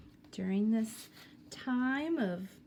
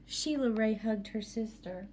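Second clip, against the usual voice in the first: plain reading voice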